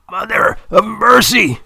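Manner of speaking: high, loud panicked cry; anguished cry. A narrator's reading. Old man seized by pain cries out, high and loud. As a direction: clutch your chest and cry out high. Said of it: "he cries".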